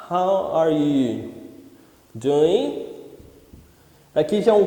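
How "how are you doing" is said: "How are you doing" drawn out very slowly with pauses; slow demo. Shown as a demonstration. Teacher modelling a greeting, very slow. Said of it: "Aprofundando um pouquinho mais".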